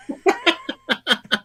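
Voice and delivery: shrieking high laugh, very loud; shrill laugh at her own scare. Real, not acted. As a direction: burst into a squealing laugh. Laughing after ghost story climax.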